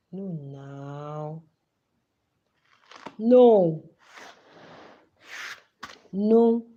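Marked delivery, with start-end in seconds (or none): 3.2-6.6 loud no. Real, not acted. Rejecting a fan's claim.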